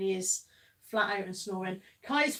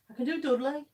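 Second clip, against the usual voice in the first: Dudley accent; 8 semitones higher than usual. Shown as a demonstration. Host asked for Brummie offers a Dudley accent. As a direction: put on broad Dudley, West Midlands. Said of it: "Can you do a Brummie accent?".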